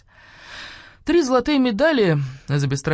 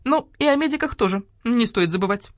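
peevish, high-pitched reminder; querulous complaint; grumpy voice; 7 semitones higher than usual. A narrator's reading. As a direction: grumble for some credit. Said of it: "ворчливый голос грача-врача".

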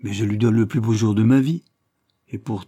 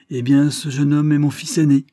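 explosive proclamation; 6 semitones higher, 7 dB louder than usual. A narrator's reading. Proud father revealing his son to the examiner, explosive and loud. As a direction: burst out high and loud with pride. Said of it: "avec explosion".

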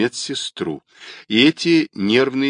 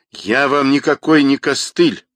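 higher, louder angry denial; sharp and angry; 6 semitones higher, 5 dB louder than usual. A narrator's reading. Rodionov interrupts the caller, sharp and angry. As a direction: cut in with irritation. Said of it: "резко и зло перебил Родионов".

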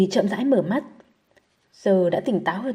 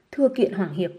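usual speaking voice

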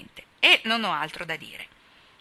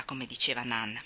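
usual speaking voice

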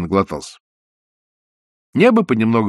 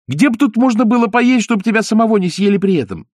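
put-on high, eager puppet boy; wooden boy voice; 10 semitones higher, 3 dB louder than usual. A narrator's reading. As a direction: pipe it like a small boy.

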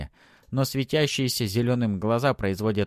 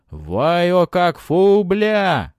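triumphant war cry; 10 semitones higher, 10 dB louder, much slower than usual. A narrator's reading. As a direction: proclaim it like a conqueror. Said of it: "С этим криком".

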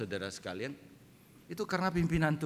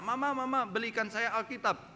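put-on high-pitched child begging mother; lightly acted child's request, voice lifted; ten-year-old child; 9 semitones higher than usual. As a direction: plead like a child wanting something. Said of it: "anak saudara sepuluh tahun minta".

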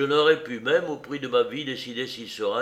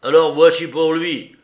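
much louder, higher furious snarl; cursing fury; 5 semitones higher, 12 dB louder than usual. A narrator's reading. Morgan drawing his knife on Jim, furious shout. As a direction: lunge at the boy with the words. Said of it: "fit Morgan avec un blasphème".

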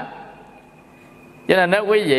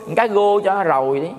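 cá gô with R softened to a G sound, easy and relaxed; Mekong Delta accent; 4 semitones higher than usual. Shown as a demonstration. A monk tells the audience how the Mekong Delta says rô, Mekong Delta R as G. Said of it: "nó chẹo cái lưỡi quá".

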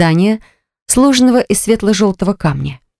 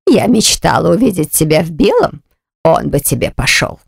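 cold aunt voice; 2 semitones lower than usual. Acted.

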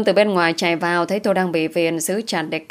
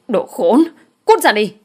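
higher, louder angry yell; 5 semitones higher, 5 dB louder than usual. A narrator's reading. Pregnant woman fighting off her attacker, yelling. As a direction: yell at him to get away. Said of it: "Tôi vừa gào".

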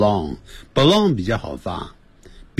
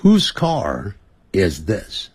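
慢速示范问句; 放慢给学生跟念; slow model question; much slower than usual. Shown as a demonstration. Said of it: "你试着看看有没有办法翻成英文". (慢慢示范 Whose car is this)

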